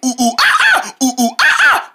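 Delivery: rising hooting ooh ooh ah ah; monkey noises. Acted. Practicing animal sounds, a monkey hooting. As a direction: hoot like a monkey. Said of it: "The monkey goes".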